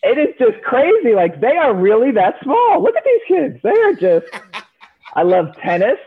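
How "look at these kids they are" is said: much higher, delighted exclamation; amazed squeal. Real, not acted. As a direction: marvel at how tiny they are. Amazed at tiny gymnasts.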